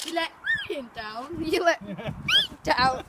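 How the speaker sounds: slow mock plea, voice cracking; hammed-up cracking voice. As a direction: let the voice squeak and break. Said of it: "I'm gonna voice cracks now".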